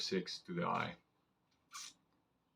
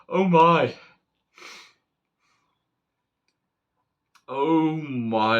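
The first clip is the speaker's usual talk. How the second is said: awed delight; 6 semitones higher, 8 dB louder, much slower than usual. Real, not acted. Marvelling at the new knife.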